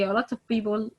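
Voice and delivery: ordinary delivery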